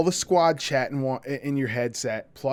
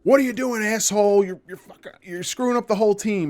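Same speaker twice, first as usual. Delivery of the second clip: higher, louder berating of a teammate; angry squad leader; 7 semitones higher, 4 dB louder than usual. Retold or imitated. A bad squad leader yelling at a rifleman, angry and much higher. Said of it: "not gonna sit there and just be a dick".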